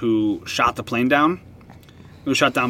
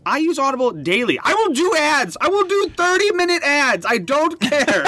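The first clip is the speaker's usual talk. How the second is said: high, eager sponsor-begging voice; squeaky hammed-up plea for a sponsorship; mock pleading ad pitch; 14 semitones higher than usual. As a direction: ham it up like you're auditioning for an ad. Begging Audible to sponsor them.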